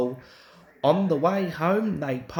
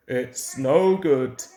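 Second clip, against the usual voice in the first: lower, slow fireman's voice; fireman's verdict; 4 semitones lower, 4 dB louder, slower than usual. A narrator's reading. Fireman telling Peter Sam he is stuck, low slow verdict. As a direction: give the bad news slowly and low. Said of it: "he said at last".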